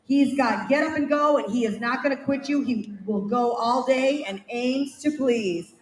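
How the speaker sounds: ordinary delivery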